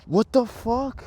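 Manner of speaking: high-pitched, baffled exclamation; stunned disbelief. Real, not acted. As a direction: let real shock lift the voice. Stunned by the broken kingpin.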